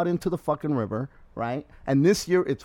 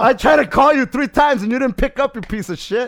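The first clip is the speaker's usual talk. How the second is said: mock worried rant; 7 semitones higher, 6 dB louder than usual. Acting out his worried rant.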